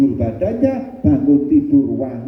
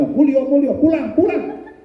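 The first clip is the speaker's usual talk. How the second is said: much higher, shooing him out; shrill chase-off; 11 semitones higher than usual. Acted. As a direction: shoo him away in a high voice. Woman chasing him out. (mengusir dengan suara jauh lebih tinggi)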